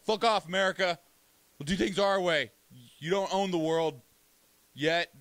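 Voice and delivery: mock defiance. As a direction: tell off the big bully.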